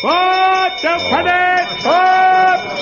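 high, loud alarm cry; frantic high-pitched shout; panicked shouting. Acted. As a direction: yell it out in a flap. Panicked calling with bell ringing again.

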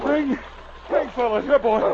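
calling out. Acted. Calling the dog from water.

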